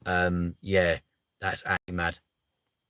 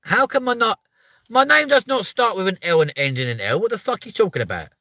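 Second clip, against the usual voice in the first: indignant outburst; 10 semitones higher, 5 dB louder than usual. Real, not acted.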